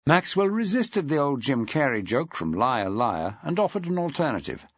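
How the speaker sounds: ordinary delivery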